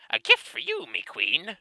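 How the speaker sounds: squeaky kobold voice jumping very high; muffled nonsense voice. Acted. Kobold offering a gift to his queen, squeaky and garbled. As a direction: gabble it like a small creature. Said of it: "in his muffled, nonsensical voice".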